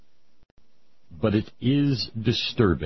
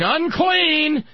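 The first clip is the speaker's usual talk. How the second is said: high, loud leper's warning cry; play-acted scream, pitched way up; mock screaming; 4 dB louder, much slower than usual. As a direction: cry it out to clear the street. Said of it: "you had to wander around screaming".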